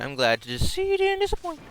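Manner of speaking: high, gleeful bear taunt; Monokuma voice. Acted. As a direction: taunt like a smug mascot.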